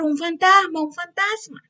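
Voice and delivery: high, loud terrified cry of ghost; frightened shout. Retold or imitated. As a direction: yell it like scared fishermen in a storm. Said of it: "Y empiezan a gritar". (grito asustado y agudo)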